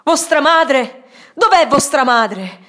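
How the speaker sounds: loud, high, anguished shout, first words slowed. Acted. Mauro shouting over the frantic mother, loud and high. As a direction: shout her down with the question. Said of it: "gridò più forte di lei".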